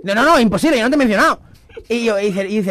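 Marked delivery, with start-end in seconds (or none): 0.0-1.4 protesting quote. Retold or imitated. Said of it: "y digo yo".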